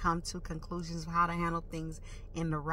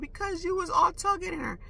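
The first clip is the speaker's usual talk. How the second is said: higher-pitched voice, lightly put on; retold young girl; 10 semitones higher than usual. Little girl answering the angry driver, lightly imitated higher voice. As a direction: quote the girl back in a raised pitch. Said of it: "the little girl that jumped out the window said".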